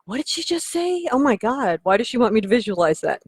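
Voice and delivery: high-pitched startled reaction; mock shock. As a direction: act out a baffled, scandalized listener. Imagined listener's startled reaction.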